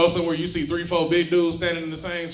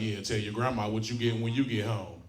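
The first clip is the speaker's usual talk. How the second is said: low menacing dad; 7 semitones lower, 5 dB quieter than usual. Retold or imitated. Father threatening in story.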